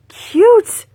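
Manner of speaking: high, loud squeal; squealing with joy; excited squeal. Real, not acted. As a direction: burst out with delight. Squealing over the shawl.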